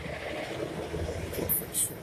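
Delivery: very slow, whispered, each word dragged out; drawn-out whispered repeat to drive the point home. Real, not acted. Repeats punchline for emphasis.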